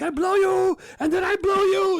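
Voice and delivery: very high, loud frantic yelp; impression of Martin Lawrence. Martin Lawrence bluffing the store clerk, high frantic yelp. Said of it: "He's like".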